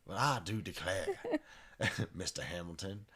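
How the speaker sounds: put-on southern belle accent.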